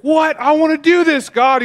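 put-on high, loud whiny child pleading with God; whiny kid. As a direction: whine like a kid who wants his way.

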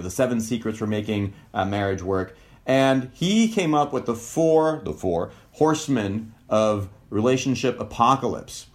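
ordinary delivery

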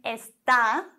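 Esta with stress on the last syllable, es-TÁ; está, stressed A. Shown as a demonstration. Teacher showing es-TÁ to German learners. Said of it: "Betonung auf dem A".

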